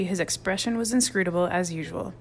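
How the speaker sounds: plain reading voice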